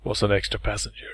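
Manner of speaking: whispered storyteller line; dramatic whisper. Pretend. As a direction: hush it like a story twist. Mock dramatic narration.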